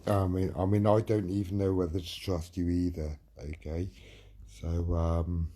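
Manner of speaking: ordinary talk, nothing marked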